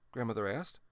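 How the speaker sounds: plain reading voice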